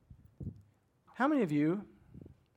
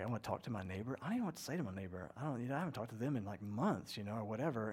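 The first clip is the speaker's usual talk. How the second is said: softer, quick, nervous muttering to yourself; nervous inner voice; 6 semitones lower, 9 dB quieter, faster than usual. Retold or imitated. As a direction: rush it out quietly, like you dread the moment. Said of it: "It's like".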